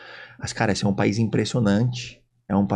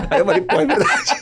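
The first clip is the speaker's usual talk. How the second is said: laughing; 12 semitones higher than usual. Real, not acted. Laughing at the joke.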